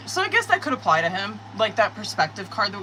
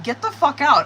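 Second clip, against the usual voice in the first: loud, higher burst of disbelief; shocked disbelief; 6 semitones higher, 6 dB louder than usual. Retold or imitated. Reader reacting to the King of Pentacles, loud shocked disbelief. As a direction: blurt it out, stunned by the cards. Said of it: "I'm like".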